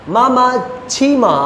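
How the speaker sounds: slow tone drill on मा मा छी मा, drawn out then falling. Shown as a demonstration. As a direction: say each syllable slowly with its own tone. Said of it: "यहाँ पे मैं खींचू, यहाँ पे मैं उसको गिरा रहा हूँ". (धीमी गति से टोन के साथ मा मा छी मा)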